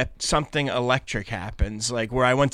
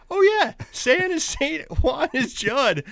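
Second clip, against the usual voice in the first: slow, much higher goofy voice; mock dumb realization; 13 semitones higher, slower than usual. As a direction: act out a silly discovery, slowly. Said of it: "I was like".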